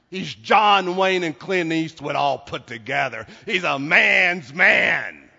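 loud, punched declaration, slowing at the end; emphatic preaching. Real, not acted. Preacher telling congregation Jesus is no softie, loud and emphatic. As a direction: belt it out from the pulpit.

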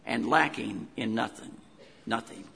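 "lacking in nothing. Nothing." said low and soft with a West Virginia twang; West Virginia accent. Pretend. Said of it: "That was my West Virginia accent coming out there for a moment".

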